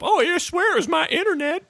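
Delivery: very high, squeaky mock excuse. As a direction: whine it high like a cartoon. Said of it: "by hanging up".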